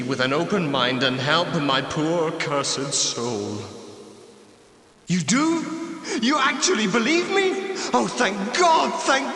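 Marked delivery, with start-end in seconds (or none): 5.1-9.3 high, breathless disbelief turning to joy; stunned relief. Acted. Ghost to stranger who believes him, overjoyed relief. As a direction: gasp out thanks as if saved at last.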